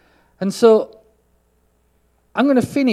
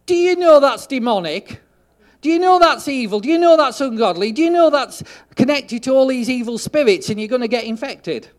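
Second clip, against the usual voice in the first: mock alarmed, higher and louder, hammering each question; preachy accusing believer; 7 semitones higher, 7 dB louder than usual. Pastor mocking believers who lecture her, loud and higher. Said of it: "The second response to this is".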